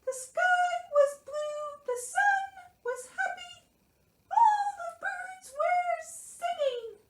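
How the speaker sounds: very high, slow storybook mouse; Mouse reading aloud. A narrator's reading. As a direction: read sweetly in a tiny mouse voice. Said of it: "Mouse read".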